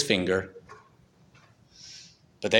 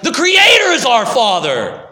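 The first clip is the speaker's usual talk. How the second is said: raised, louder angry shout; angry yell; 8 semitones higher, 6 dB louder than usual. Acted. Authorities yelling back at Jesus in anger. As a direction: yell it back at him in outrage.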